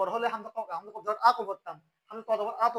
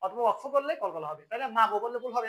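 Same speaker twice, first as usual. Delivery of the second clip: normal talking voice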